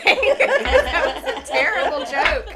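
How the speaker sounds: loud, high, slow laughing words; cracking up at her own bad joke. Real, not acted. Owner laughs at her terrible dad joke, laughing loudly.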